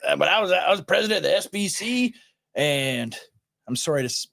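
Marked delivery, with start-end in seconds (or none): 0.1-3.2 higher-pitched mock Southern pastor voice; J D Greear, Southern accent. Podcaster posing as a former SBC president, mocking Southern accent. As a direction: imitate a Southern Baptist leader's accent. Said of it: "for doing this demeaning accent".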